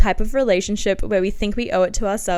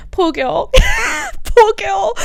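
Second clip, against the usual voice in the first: laughing pity; 13 semitones higher, 5 dB louder, slower than usual. Real, not acted. Laughing at her teen diary entry, high laughing cry. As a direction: break up laughing while pitying her.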